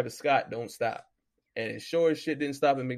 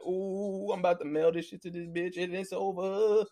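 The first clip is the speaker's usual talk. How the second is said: lightly voiced coworker, a bit higher and giddy; gleeful coworker; 5 semitones higher than usual. Coworker mailing his last alimony check, lightly mimicked with glee. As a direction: play the coworker who's nearly free.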